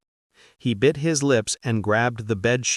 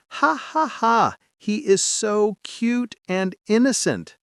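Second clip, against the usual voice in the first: gushing laugh; 9 semitones higher than usual. A narrator's reading. Viscount gushing over Nux.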